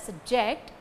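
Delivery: subJECT as a verb, weight on JECT; stress on second syllable. Shown as a demonstration. Teacher demonstrates the verb form, subJECT stressed last. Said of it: "it is verb".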